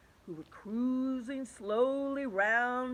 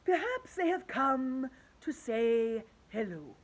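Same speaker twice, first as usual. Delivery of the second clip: put-on old grasshopper; 3 semitones higher, 4 dB louder, slower than usual. A narrator's reading. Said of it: "said the old green grasshopper".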